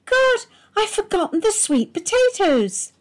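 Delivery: high, loud sudden panic; a high cry of alarm over a forgotten dish; dismayed cry. A narrator's reading. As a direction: yelp it out as she remembers. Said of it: "Margaret let out a cry".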